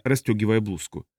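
plain reading voice

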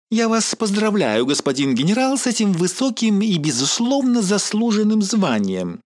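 high, sugary flattering anchor; fawning. Acted. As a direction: lay the flattery on thick. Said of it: "изобразила изящный подхалимаш ведущая".

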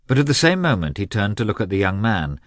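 ordinary delivery, nothing marked

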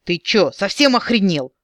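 high, loud furious howl; howling yell. A narrator's reading. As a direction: howl it at the boss. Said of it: "взвыл Фрэнк".

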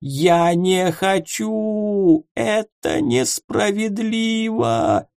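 very slow, high whimpering sob. Acted. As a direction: whine like a beaten child. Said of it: "хныкал он".

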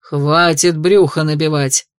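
low, slow ghostly bark. Acted. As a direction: snap at her like a cranky spirit. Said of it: "рявкнул дух".

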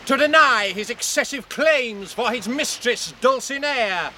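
ringing formal proclamation; knight's loud challenge. Acted. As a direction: proclaim the accusation for the whole crowd. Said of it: "gives voice to his challenge".

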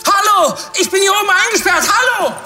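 high, strained, frantic yell; desperate high-pitched hollering; shouting for rescue. Acted. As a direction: yell down to people below, fast. Locked-in director yells down.

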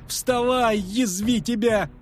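a bard shouting in a cloud of flour. Acted. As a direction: yell at him to get up. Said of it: "кричал Трубадур".

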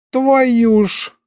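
put-on high-pitched dismayed old man; shocked exclamation. A narrator's reading. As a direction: cry out as if struck by the news. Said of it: "Дед со всхлипом втянул воздух".

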